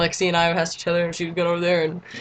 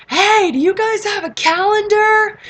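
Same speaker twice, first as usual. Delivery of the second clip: high, drawn-out holler; loud call-out; 8 dB louder, slower than usual. Retold or imitated. Loud girl asking passing performers for a calendar, high shouted call. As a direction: yell it slowly across the stands. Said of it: "I'm that loud one".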